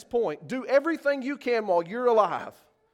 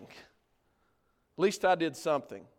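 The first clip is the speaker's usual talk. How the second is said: mock excuse; 4 semitones lower, 4 dB quieter, slower than usual. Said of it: "He will say".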